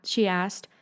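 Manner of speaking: ordinary delivery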